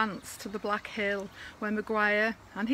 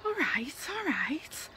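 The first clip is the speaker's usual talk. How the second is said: whispered reply; 6 semitones higher than usual. Acted. Man answering the call.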